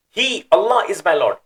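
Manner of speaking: HE lifted very high, ALLAH strong and loud; emphatic. Shown as a demonstration. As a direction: exclaim he, Allah with weight. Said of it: "that emphasis is coming about".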